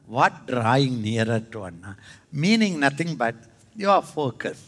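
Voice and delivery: ordinary talk, nothing marked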